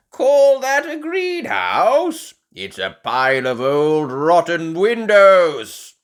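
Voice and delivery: mock the neighbour's greenhouse; scoffing grandpa. A narrator's reading. Grandpa Pig scoffs at Grandad Dog's greenhouse, loud and mocking.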